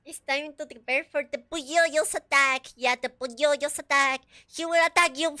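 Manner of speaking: loud hammed-up prophecy of the attack; mock doom warning. Streamer warning chat, loud mock-ominous announcement. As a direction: boom it out like a doomsayer. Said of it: "mock doom announcement to chat".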